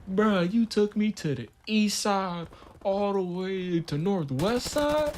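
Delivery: high, drawn-out mock disbelief; incredulous complaint. Storyteller mimics the other dude complaining about the ride, incredulous.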